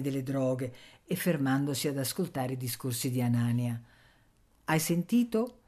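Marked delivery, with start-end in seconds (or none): 4.6-5.6 domanda acuta e candida; ingenua esclamazione. A narrator's reading. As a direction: esclama con ingenuo stupore. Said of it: "esclamò ingenuamente zia Tatana". (esclamazione ingenua, tono alto)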